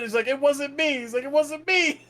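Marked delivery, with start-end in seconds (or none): 0.3-1.0 very high-pitched mock pleading; frantic denial. Gulag player denying he shot, frantic high denial. 1.2-2.0 very high-pitched mock pleading; frantic denial. Gulag player denying he shot, frantic high denial.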